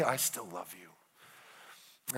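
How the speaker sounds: slow, very soft and low; quiet, intimate vow of love; tender reassurance. Acted. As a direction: reassure your wife, hushed and lingering. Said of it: "he wants her to know".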